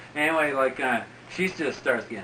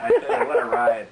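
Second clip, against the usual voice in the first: laughing; 5 dB louder than usual. Real, not acted. Laughing retelling the ride.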